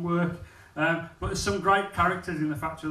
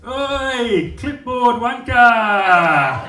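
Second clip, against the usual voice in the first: loud, slow, higher jeering yell; factory heckle; 7 semitones higher, 11 dB louder, much slower than usual. Retold or imitated. Next worker heckles the man in the suit, loud slow yell. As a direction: hurl the insult across the floor, drawn out. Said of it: "Got to the next workstation".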